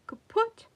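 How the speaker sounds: loud, high burst of sound for a yank; sharp jerk noise. A narrator's reading. The storyteller voices Whale's big jerk, a loud high sound effect. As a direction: snap it out like a rope going taut.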